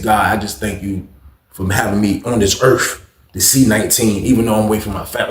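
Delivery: low, subdued thanks; sincere prayer. Retold or imitated. Prisoner privately thanking God on his birthday, low and sincere. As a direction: confide it quietly, deep in the voice.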